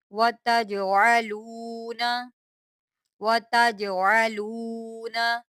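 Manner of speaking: slow, drawn-out tajweed recitation of वतजअलूना; Quran recitation. Shown as a demonstration. Teacher models the verse for students, slow recitation. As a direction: chant each syllable slowly. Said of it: "सबक का आगाज़ करते हैं".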